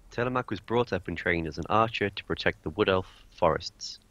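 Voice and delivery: ordinary talk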